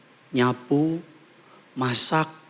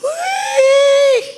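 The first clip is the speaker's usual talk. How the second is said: loud, very high drawn-out "wiih"; over-the-top mock fan whoop; gushing commenter squeal; 8 dB louder than usual. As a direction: squeal in exaggerated admiration like a fan. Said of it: "Langsung komen".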